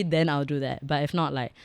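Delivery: ordinary delivery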